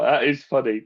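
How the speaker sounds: normal talking voice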